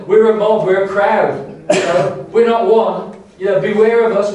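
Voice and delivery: a loud demon horde warning, voiced by the preacher. The demons threatening Jesus, a loud mock-menacing voice. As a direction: boom it out as a crowd of demons. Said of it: "the demonic voice that says".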